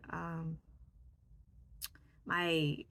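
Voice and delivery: normal talking voice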